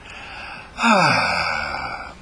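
long voiced sigh of release; relaxed sigh. Shown as a demonstration. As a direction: melt into a sigh. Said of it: "like a lovely sigh".

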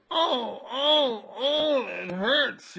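high, loud drawn-out oh's; acted cry of pain. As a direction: wail it out slowly like a stubbed toe. Acting out stubbing a toe.

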